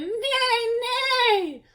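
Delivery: high, sung neigh; chicks neighing. A narrator's reading. Chicks run around shouting a high neigh. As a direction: neigh like a horse, squeaky. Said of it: "ran around shouting".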